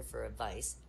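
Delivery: normal talking voice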